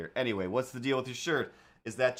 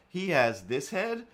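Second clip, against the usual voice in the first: normal talking voice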